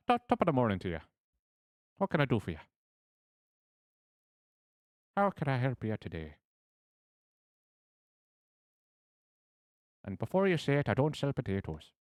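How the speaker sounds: high, loud stage-Irish shopkeeper; a hammy Irish weapon seller greeting customers; bad Irish accent. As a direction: ham up a cheery Irish brogue. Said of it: "Any Irish people, I deeply apologize".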